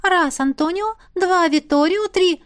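высокий голос духа. Acted. Дух Искра ведёт перекличку.

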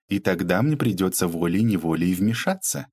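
ordinary delivery

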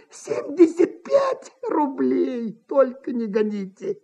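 slow, strained, high plea; hissed begging, running out of breath; breathless hissing. A narrator's reading. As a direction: gasp out a desperate offer. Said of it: "прошипел он, задыхаясь".

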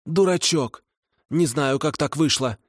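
high hag voice; kikimora voice. A narrator's reading. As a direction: explain grudgingly. Kikimora continuing answer.

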